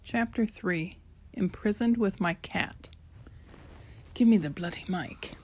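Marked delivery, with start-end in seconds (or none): 4.1-5.4 whispered aside to her brother; whispered grab. Retold or imitated. Sister demands the mic from her brother, whispered. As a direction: hiss it off-mic, impatient.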